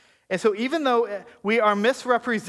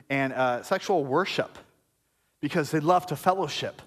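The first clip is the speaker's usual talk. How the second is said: normal talking voice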